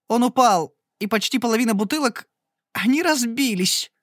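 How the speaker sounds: high, breaking, tearful voice; sobbing aloud. A narrator's reading. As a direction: wail it out through tears. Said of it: "уже в голос зарыдала". (в голос рыдая, высоко)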